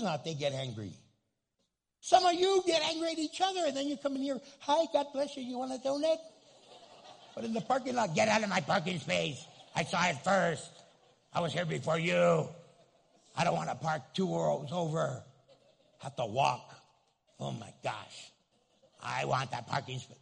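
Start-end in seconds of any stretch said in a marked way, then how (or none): 2.0-4.4 much higher, louder preaching push; raised preaching. Real, not acted. Preaching at high pitch. 4.6-6.2 high, loud fake-friendly greeting; sing-song church welcome, higher; fake-nice churchgoer offering a donut; mock sweet greeter. 8.1-20.2 loud, hammed-up parking lot yell; mock parking rage. Angry driver in parking lot.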